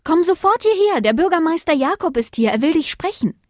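high, loud, urgent wife voice; summoning wife. Acted. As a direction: call your husband over at once. Wife summons her husband.

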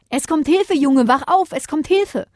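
high-pitched, excited call that help is here; urgent wake-up call. A narrator's reading. Bodo wakes the boy as help arrives, urgent. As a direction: call out high to rouse a sleeper.

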